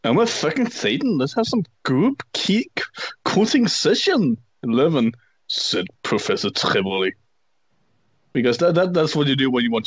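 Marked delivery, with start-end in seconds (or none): 0.0-4.4 put-on whiny emo teen. Reading fanfic quote.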